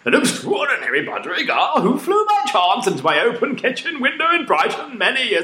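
high, strained put-on voice of a wild boy; crazy boy voice. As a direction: read it as a manic young lad. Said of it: "this is my crazy boy voice".

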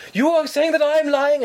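high, slow mock indignation; imitated angry scammer. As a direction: mimic an affronted caller, voice shooting up. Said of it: "they got really angry".

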